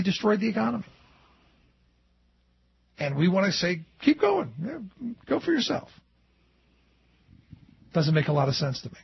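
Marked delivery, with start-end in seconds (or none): 4.0-5.9 high-pitched, airy go-ahead; mock encouragement. Host voices the public indulging the banks, mock encouragement. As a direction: wave them on in a higher mocking voice.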